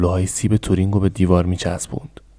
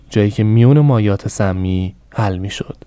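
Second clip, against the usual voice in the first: ordinary delivery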